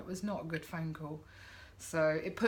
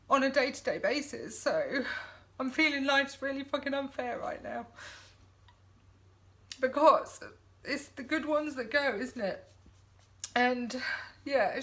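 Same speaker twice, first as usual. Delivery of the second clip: tearful, high, breaking voice; talking through tears; crying; 6 semitones higher than usual. Real, not acted. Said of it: "I'm having a real weepy moment".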